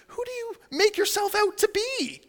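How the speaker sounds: very high, affronted question; incredulous challenge. Acted. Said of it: "they say this question to Jesus".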